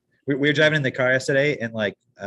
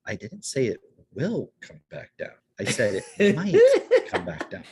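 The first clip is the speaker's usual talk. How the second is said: imitating the boy; 6 semitones higher than usual. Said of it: "He goes".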